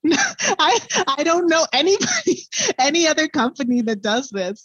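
high, laughing while talking; giggly and excited. Real, not acted. Laughs while praising company.

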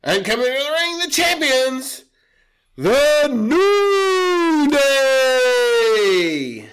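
booming ring announcer voice. Pretend. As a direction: announce it to the arena.

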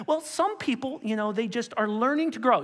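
high-pitched, hedging protest; lenient objector. Acted. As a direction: plead gently for some leeway. Acted lenient objector to John.

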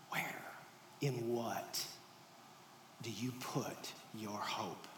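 soft, slow, sinking low; gentle question. Acted. Jesus gently asks disciples about hope. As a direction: ask it tenderly, quietly.

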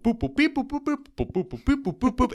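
high, sung-like boop boop beep jingle; imitated music cue. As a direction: hum the show's update jingle. Imitating the Unsolved Mysteries music.

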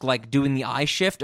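ordinary delivery

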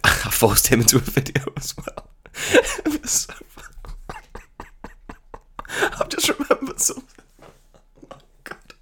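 breathy giggle through words; laughing. Real, not acted. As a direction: whisper while laughing. Giggling over a memory.

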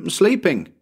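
put-on high child's one-word answer; little boy. Tommy answers Bill's question, high boy voice. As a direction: pipe it up innocently.

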